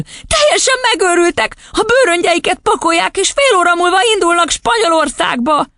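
put-on very high little-girl voice; squeaky, agitated outburst; upset child crying out. A narrator's reading. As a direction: blurt the dreadful news like a child. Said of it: "kiáltotta végül".

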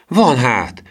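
put-on high, eager boyish cry; exclaimed. A narrator's reading. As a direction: answer with a bright high exclamation. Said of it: "kiáltotta Emil".

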